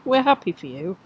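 teasing imitation of sarcastic congratulations; amused mimicry of insincere well-wishing; mock sarcasm. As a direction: parrot it back in mock sarcasm. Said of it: "That was so sarcastic".

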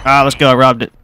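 normal talking voice

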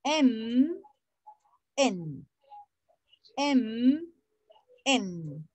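M with closed lips, then N with open lips, alternating; M and N contrast. Shown as a demonstration. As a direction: drill "M" against "N", showing the lip difference. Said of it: "Es diferente la pronunciación". (contraste M y N en inglés)

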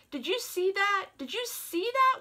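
high, hushed doll voices; whispering dolls. Acted. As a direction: gasp it out high like startled toy dolls. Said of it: "whispered the dolls".

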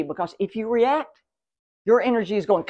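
ordinary talk, nothing marked

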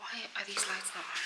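real soft whisper. Real, not acted. Upstairs waiting for the client, whispering. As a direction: whisper the plan to herself. Fretting over the dark lights.

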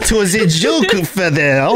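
high cartoon French accent, half laughing; Pepe Le Pew French accent. Joking with a friend in a high Pepe Le Pew French accent. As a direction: play a cartoon French skunk, hammed up. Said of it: "That's the only reason I can do that accent".

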